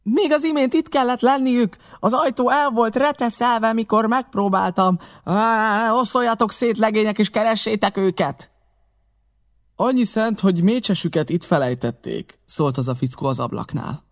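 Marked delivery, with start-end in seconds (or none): 0.0-8.5 high, urgent raised voice; rally the gang to search; frustrated high-pitched hollering; shouted orders. A narrator's reading. Pew keeps shouting orders. 9.8-12.4 raised, pitched-up voice; calling down. A narrator's reading. Man calls down from window.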